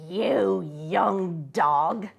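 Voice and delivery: very slow, savouring menace; a hungry convict taunting the boy; menacing convict. A narrator's reading.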